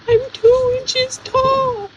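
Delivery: slow, tiny little-man voice; small-voiced guest. Tiny guest tells the host his height, small-voiced. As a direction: speak slowly in a miniature person's voice. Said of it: "makes me small voiced".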